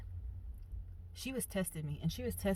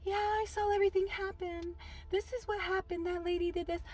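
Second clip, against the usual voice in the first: high, lightly mocking mom voice; eager mom; 12 semitones higher than usual. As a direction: imitate mom eagerly testifying. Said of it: "my mom was over here like".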